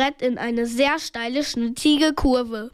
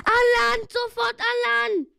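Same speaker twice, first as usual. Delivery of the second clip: high, urgent panicked yell; high-pitched cry of alarm; yelled command; 9 semitones higher than usual. A narrator's reading. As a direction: shout for everyone to get out. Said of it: "brüllte Peter".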